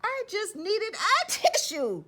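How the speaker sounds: high, slow snuffly voice; sniffly wolf. Acted. Wolf explaining he only wanted a tissue, sniffly. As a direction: sniffle through it sheepishly.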